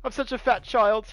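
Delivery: high, whimpering mock sob of a pathetic boy; whiny pathetic Eddie. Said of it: "and Eddie's like".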